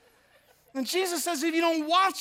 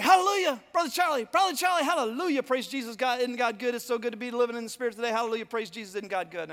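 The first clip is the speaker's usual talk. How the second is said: gushing Pentecostal lady; 3 dB louder than usual. Retold or imitated. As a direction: pour out the hallelujahs like an overeager churchgoer. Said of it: "she was very extroverted Pentecostal".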